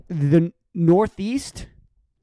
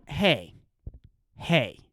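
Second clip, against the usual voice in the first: mocking the excuse maker; 5 semitones lower, 4 dB quieter than usual.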